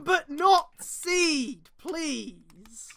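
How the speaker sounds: loud, very slow mock begging; loud drawn-out plea. Real, not acted. Pleading joke about Gundam.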